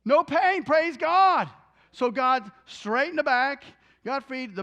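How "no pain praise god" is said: high, loud exclamation of relief; mother marveling loudly that the pain is gone; retold joyful outburst. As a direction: cry out praise at sudden relief from pain. Retold healed mother rejoicing.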